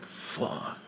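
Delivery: whispered, breath-only swear; dismayed curse. Retold or imitated. Fighter retelling his back being taken, whispered dismay. Acted dismay when losing.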